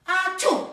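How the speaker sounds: loud, high mock sneeze; estornudo fingido. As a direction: sneeze explosively into someone's ear. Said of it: "Ahí le estornudó". (estornudo fingido, fuerte y agudo)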